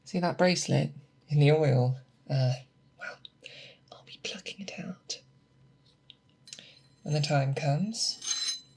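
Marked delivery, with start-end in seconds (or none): 3.0-5.2 breathy aside, hushed to a whisper. Acted. Mentor whispering to apprentice over the oil. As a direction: let it slip out as a whispered aside.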